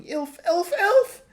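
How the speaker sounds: high, loud desperate scream; full-force shriek for help. A narrator's reading. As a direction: scream it out as if falling. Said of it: "She went on screaming".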